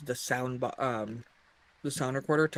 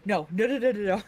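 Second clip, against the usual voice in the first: quick raised flustered protest; 6 semitones higher, 4 dB louder than usual. Retold or imitated. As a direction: brush it off in a rush. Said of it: "he was just like".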